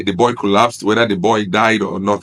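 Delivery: ordinary talk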